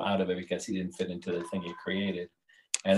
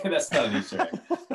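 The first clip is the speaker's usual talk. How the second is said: laughing; 7 semitones higher, 5 dB louder than usual. Real, not acted. Laughs correcting himself.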